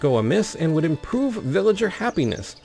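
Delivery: storyteller's measured read-aloud voice; story narration. A narrator's reading. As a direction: tell it like a fantasy chronicle.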